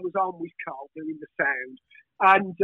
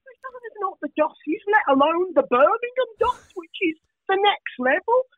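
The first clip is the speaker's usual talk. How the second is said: mocking scoff; 8 semitones higher than usual. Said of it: "we're like".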